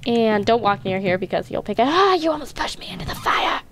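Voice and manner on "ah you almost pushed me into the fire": high yelp of protest; startled cry. Real, not acted. Startled at being pushed near fire.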